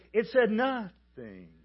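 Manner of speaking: loud, high setup, then "nothing" stretched slowly; drawn-out NOTHING. Acted. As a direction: punch and stretch "nothing" like a punchline. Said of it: "hear it said that way".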